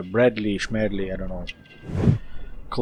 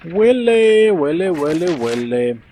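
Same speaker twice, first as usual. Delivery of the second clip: playful name-calling; 5 semitones higher, 3 dB louder than usual. Pretend. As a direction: stretch the name out, starting high and falling. Playfully calls out the chosen name.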